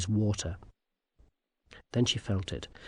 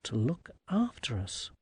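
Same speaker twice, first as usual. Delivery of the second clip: softer, musing aside; inward thought; 7 dB quieter than usual. A narrator's reading. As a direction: think it quietly to oneself. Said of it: "thought Tiffany".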